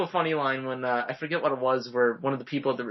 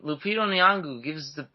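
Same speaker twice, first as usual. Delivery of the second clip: normal talking voice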